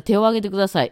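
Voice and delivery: 「手」 short, straight into 「を」; short vowel. Shown as a demonstration. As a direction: say 「手」 without stretching it. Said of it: "伸ばさないんですよ". (「手」を伸ばさずに言う)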